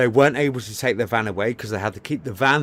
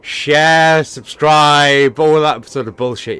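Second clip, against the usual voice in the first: loud plug; 3 semitones higher, 9 dB louder, slower than usual. Real, not acted. Streamer urging viewers to subscribe.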